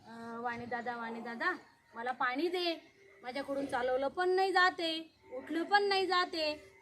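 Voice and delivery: the crippled hunter begs the shopkeeper for water, faintly; weak plea. Acted. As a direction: beg for water with no strength left.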